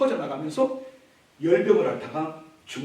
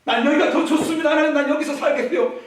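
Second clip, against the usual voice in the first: pleading king; 8 semitones higher, 5 dB louder than usual. Acted. Hezekiah refuses to go.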